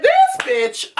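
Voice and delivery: high, stunned outrage; outraged disbelief. Real, not acted. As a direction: spit it out in shock. Outraged reaction to boss.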